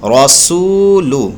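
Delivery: रसूलु drawn out with a heavy रॉ; slow recitation. Shown as a demonstration. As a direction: recite रसूलु slowly, the रॉ full. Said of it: "पूरे जुमले को पढ़ेंगे".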